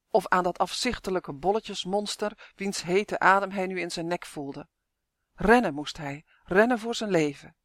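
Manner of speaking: usual speaking voice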